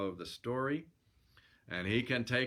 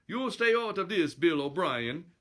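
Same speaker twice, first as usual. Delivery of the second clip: loud mock-Swedish threat; high, blustering Swedish challenger; Swedish brute; 8 semitones higher, 6 dB louder than usual. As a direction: warn him off in a comic Swedish accent. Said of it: "waving his huge fists around menacingly".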